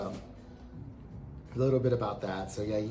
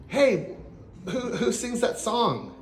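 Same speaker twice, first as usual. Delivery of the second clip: high, raised-voice query; calling out; 11 semitones higher, 4 dB louder, slower than usual. Retold or imitated. Asking his chief about the song on the radio, calling out. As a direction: call over urgently, pitch jumping up.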